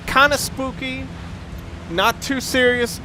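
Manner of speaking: ordinary talk, nothing marked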